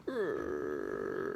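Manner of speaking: low rock rumble; rumbling growl. Retold or imitated. Boulder starting to roll, imitated as a low rumble.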